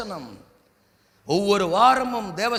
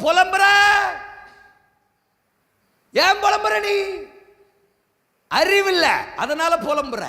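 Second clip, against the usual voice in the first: scolding rebuke; 8 semitones higher, 4 dB louder than usual. Acted. Preacher rebukes the complainer.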